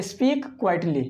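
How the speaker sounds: stretched out word by word; teacher spelling out the phrase slowly so students can repeat it; slow model phrase. Shown as a demonstration. Teacher giving the alternative phrase to the class, slowly. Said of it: "या इसके जगह पे".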